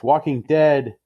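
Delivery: ordinary delivery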